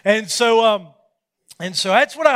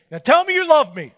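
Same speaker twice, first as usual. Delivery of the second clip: imitating wife; 7 semitones higher, 7 dB louder than usual. Wife voiced, higher.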